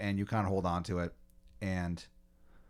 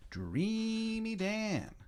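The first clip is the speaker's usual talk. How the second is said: high, soft, very drawn-out name; dream figure's self-introduction; 12 semitones higher, 7 dB quieter, much slower than usual. Acted. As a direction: float the name out high and long.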